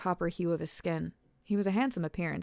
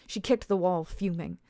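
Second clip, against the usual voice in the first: usual speaking voice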